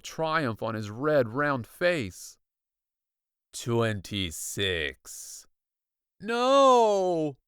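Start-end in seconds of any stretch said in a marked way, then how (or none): 3.5-5.5 chapter heading. A narrator's reading. Chapter number read out. 6.2-7.4 sharp, high, loud cry of no; cried out. Acted. Boy crying out as the doctor grabs him. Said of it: "I cried".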